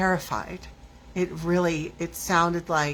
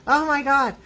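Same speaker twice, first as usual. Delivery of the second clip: mild freaked-out gasp of words, higher and louder; a touch of panic, retold; 8 semitones higher, 5 dB louder than usual. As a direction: act out a moment of alarm. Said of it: "freaking out 'cause there's stuff".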